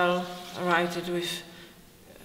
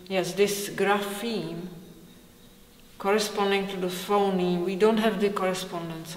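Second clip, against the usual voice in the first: ordinary talk, nothing marked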